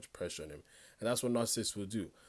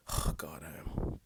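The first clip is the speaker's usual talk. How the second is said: mock whisper; 4 semitones lower, slower than usual. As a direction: fake breathy whisper of a narcissist. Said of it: "if they say sorry like".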